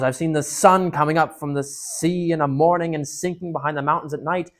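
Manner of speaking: loud, stubborn, slowing to a firm close; defiant speech. Acted. Puddleglum defying the witch, loud and stubborn. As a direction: stand your ground against the witch.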